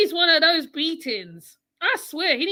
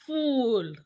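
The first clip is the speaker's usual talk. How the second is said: ordinary talk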